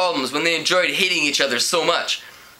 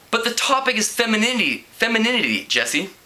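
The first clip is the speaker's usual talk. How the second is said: pointedly; 8 semitones higher, faster than usual. A narrator's reading. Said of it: "Kelly said pointedly".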